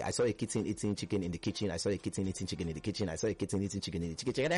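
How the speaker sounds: rattled off fast; fast tongue twister. Shown as a demonstration. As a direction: race through the twister. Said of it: "make sure it's fast".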